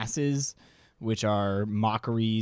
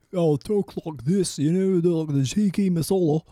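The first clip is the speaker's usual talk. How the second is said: a lightly put-on Leeds accent, a bit higher; 4 semitones higher than usual. Mimicking Leeds relatives saying tiki masala, light Leeds accent. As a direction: imitate folk from Leeds swallowing the words. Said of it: "they would sound more like you 'cause they, they were from Leeds".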